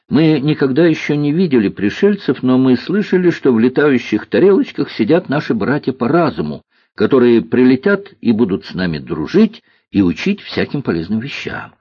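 put-on high, eager boy voice; увлечённый мальчик. Мальчик увлечённо рассказывает о пришельцах. (увлечённый мальчишка, высоким голосом)

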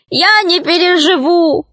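high, theatrical gnome lament; sobbing gnome. A narrator's reading. Gnome grieves for his doll bride, acted wailing. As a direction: wail it high, as if heartbroken. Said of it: "рыдал гном".

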